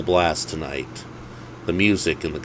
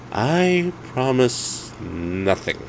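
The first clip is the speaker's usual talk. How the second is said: slow, higher, different voice for Ted; Ted's voice; 7 semitones higher, much slower than usual. Acted. To his friend at the bar, slow teasing refusal. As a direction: drawl it slowly, teasing promise. Friend's dry reply in dialogue.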